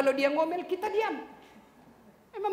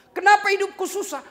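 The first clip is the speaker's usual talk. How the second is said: louder, higher lament of a troubled man; complaining believer; 12 semitones higher, 8 dB louder than usual. Retold or imitated. Troubled man complains about his hard life, louder. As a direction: cry out the complaint.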